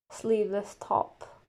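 ordinary delivery

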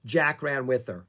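plain reading voice

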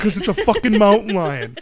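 loud, high, laughing exclamation; laughing punchline. Real, not acted. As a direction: blurt it out through real laughter.

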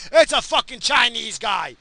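loud angry call. Real, not acted. Angrily shouting at a driver.

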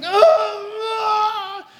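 loud, high drawn-out cry; acted scream of grief; anguished wail. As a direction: wail helplessly as if watching your child die. Acting father watching son die.

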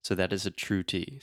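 usual speaking voice

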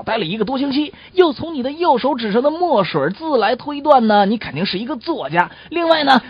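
loud, emphatic voice with halting fillers; coachman deducing. Acted. Coachman explaining his clues to the writer, loud laboured deduction. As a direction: reel off each clue loudly, pausing on "uh". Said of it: "赶车的人说道".

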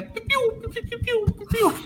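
high squeaky "piu" beeps; imitated beeping. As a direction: chirp it like a game sound. Imita som de jogo. (piu piu agudo imitando jogo)

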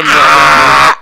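loud, long full-out scream. Retold or imitated. Player acting out his character bursting into the store, screaming. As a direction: scream as if charging in wildly. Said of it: "I run in screaming like a total".

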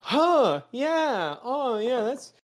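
mimicking him. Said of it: "he is just like".

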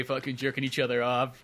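ordinary talk

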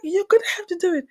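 high, fast retort; insistent mother. Retold or imitated. Mother insisting over daughter's screams, insistent.

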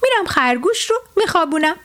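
pleased, eager to find a sleeper; happy. Acted. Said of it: "با خوشحالی گفت".

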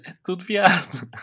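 high, loud camp exclaim; excited high exclamation. Real, not acted. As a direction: shout it up high with glee.

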